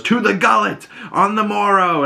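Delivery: high, theatrical battle toast; rally the crew, hammed up; mock rallying cry. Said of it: "stands up and goes".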